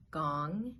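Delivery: ordinary talk, nothing marked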